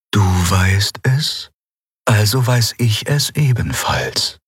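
lightly voiced otherworldly being, slow; gently declining; calm eidolon. Yunnan dryly declines Endriel's proposal, lightly voiced and slow.

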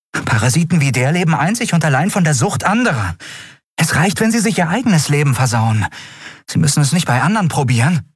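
high, heated tirade; hateful rant. A narrator's reading. Andriel tells Kai why she hates dealers, heated. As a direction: let contempt push the pitch up. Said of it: "ich hasse diesen Abschaum".

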